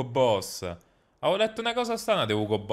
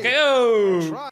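sudden loud startled cry, higher; startled shout; 8 semitones higher, 7 dB louder than usual. Real, not acted. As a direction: jump at the dog going mad. Il cane impazzisce all'improvviso. (grido di sorpresa improvviso)

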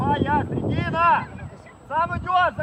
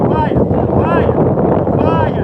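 loud drawn-out call across the pitch; yelling from the sidelines; 3 semitones higher, 11 dB louder, slower than usual. Real, not acted. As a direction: shout the name long and loud. Coach calling from sideline.

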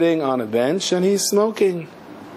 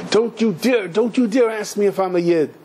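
higher-pitched indignant retort, no real yell; retold venting, pitched up; mock angry venting; 6 semitones higher than usual. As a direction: act out a mild indignant outburst. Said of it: "venting and screaming and yelling".

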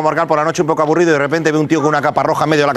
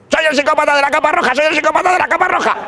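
much higher silly villain voice; a hammed-up menacing psychopath, pitched way up; psycho robber voice; 11 semitones higher than usual. Second robber in red cape.

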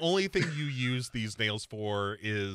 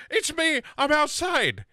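very high chirpy mock voice; mock Sarah; 14 semitones higher, 4 dB louder than usual. Friend mocking Sarah at the door, very high and chirpy. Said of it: "she's like".

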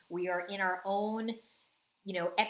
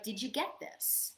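softer, slow innocent ask; gently innocent, no blame; 7 dB quieter than usual. Shown as a demonstration. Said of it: "we want to be innocent and be like".

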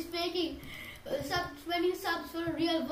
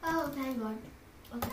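ordinary delivery

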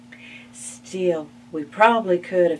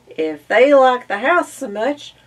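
eager; 7 semitones higher, 10 dB louder than usual. Acted. Narrator urging the move.

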